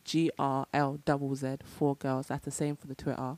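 low, soft seductive voice; sexy voice. Real, not acted. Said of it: "What is this sexy voice?".

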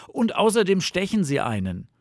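high, loud objection; complaining fox. A narrator's reading. Fox remembers summer's mosquitoes, complaining. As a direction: add it indignantly in a high voice.

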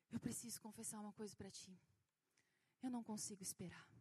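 put-on low, soft confessing child; mock confession. Son owns up to his mother that he can't wait, low mock confession.

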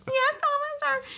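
very high, soft, sheepish reply; meek squeak. Retold or imitated. Answering the police officer in a tiny high voice. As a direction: squeak it meekly like a caught kid. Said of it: "I was like".

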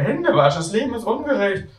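high, hammed-up wail; a grown-up faking a kid's tantrum; mock tantrum.

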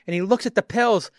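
ordinary talk

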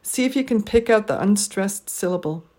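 usual speaking voice

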